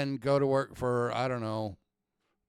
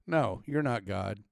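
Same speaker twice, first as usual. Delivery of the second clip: usual speaking voice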